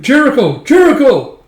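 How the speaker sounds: high, loud desperate call. Acted. Commander calling his champion to fight, high yell. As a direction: yell the name across the hall.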